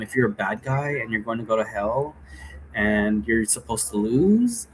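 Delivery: ordinary talk, nothing marked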